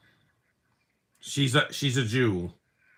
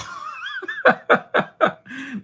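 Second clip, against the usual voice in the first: high-pitched staccato cackle; real laughter; 5 dB louder than usual. Real, not acted. Streamer amused by the film's hijacking gag, high cackling laugh. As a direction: burst into a loud, high giggling laugh.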